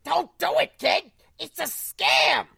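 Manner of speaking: squawky parrot voice. Acted. Iago parrot voice in script.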